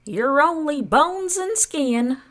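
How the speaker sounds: very high, drawn-out taunt; indignant, high-pitched aunt; Aunt Sponge crying out. A narrator's reading. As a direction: wail the insult slowly as a scornful aunt. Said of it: "Aunt Sponge cried out".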